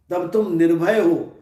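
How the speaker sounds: ordinary delivery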